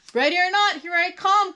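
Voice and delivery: high, loud seeker's call. A narrator's reading. Molly calls to hidden friends, calling out. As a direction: sing out a warning to the hiders. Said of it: "called Molly".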